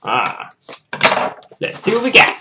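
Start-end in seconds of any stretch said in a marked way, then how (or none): 1.6-2.4 high, rising excitement; eager anticipation. Real, not acted. Vlogger opening the package, eagerly curious. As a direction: build to the reveal.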